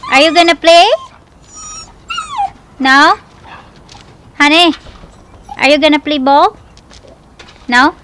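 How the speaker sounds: sweet high voice for a puppy; pet talk. Real, not acted. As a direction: coo at the dog to play. Baby talk to the dog.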